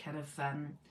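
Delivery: soft husky voice, slowed down. Shown as a demonstration. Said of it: "Yeah, like that".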